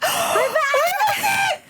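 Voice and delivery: high sudden gasp of shock; sharp gasp. Retold or imitated. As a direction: gasp at the good news. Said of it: "And I was like".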